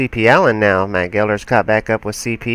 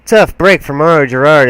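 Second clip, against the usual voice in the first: excited; 6 semitones higher, 9 dB louder than usual. Real, not acted. Crash spins a driver off.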